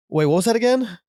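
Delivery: high puzzled question; suddenly lost mid-sentence, baffled; confused. Retold or imitated. Therapist losing track mid-session, confused.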